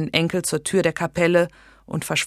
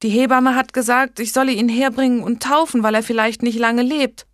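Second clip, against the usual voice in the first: raised, hurried excuse; flustered young woman; 6 semitones higher, 4 dB louder than usual. A narrator's reading. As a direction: justify herself nervously. Blanche excuses herself, flustered.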